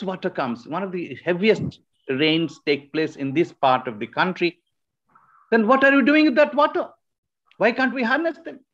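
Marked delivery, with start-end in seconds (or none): 5.5-8.6 high, fast, loud, in someone else's voice; quoting people pushing to harness the rain, high and fast; mock demanding voice. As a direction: play the eager official asking why the water is not used. Quoting people asking about rainwater.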